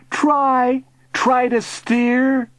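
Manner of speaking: high, drawn-out plea; desperate calling. A narrator's reading. Boy calling to uncle adrift, pleading. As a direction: urge them on from below. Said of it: "I called".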